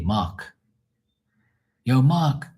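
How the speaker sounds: "Mark" with no R, like "Mahk"; Bermudian Mark without R. Shown as a demonstration. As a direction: drop the R in "Mark", long open vowel. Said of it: "There's no R".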